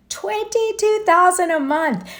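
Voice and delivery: can you believe that price; incredulous. Real, not acted. Host repeating the mortgage figure to viewers, incredulous.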